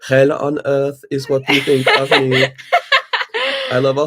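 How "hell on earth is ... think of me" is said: put-on loud, laughing German accent; mock German through laughter. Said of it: "sounding like the German accents you're doing".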